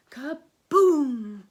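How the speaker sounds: very loud crashing holler. A narrator's reading. Skippy crashing home, hollering loud. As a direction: blast it out like an explosion. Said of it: "he hollered".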